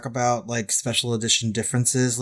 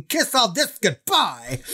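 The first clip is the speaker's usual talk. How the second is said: higher-pitched imitation of her tirade; mock outburst; 8 semitones higher, slower than usual. Heroine crumbling up papers at the inquiry, mock outburst. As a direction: act out her outburst with amusement. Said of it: "she's crumbling up those papers".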